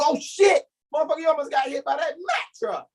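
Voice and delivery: high, fast alarmed yelp at a near miss; pulling a friend from a truck, high startled yell; startled rescue shout. Acted. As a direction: yank them back and yell in alarm.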